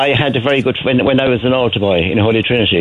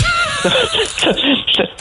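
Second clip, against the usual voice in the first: high burst of laughter; real high laugh. Real, not acted. Laughing at retold story.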